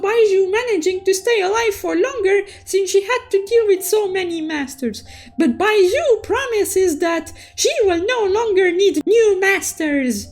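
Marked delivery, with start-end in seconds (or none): none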